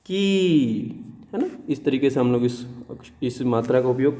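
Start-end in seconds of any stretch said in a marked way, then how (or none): 0.1-1.1 "की" with a long, high ee; drawn-out, higher-pitched "की"; long vowel demonstration. Shown as a demonstration. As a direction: stretch "की" out like keee for learners. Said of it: "इसको हम कैसे पढ़ेंगे?".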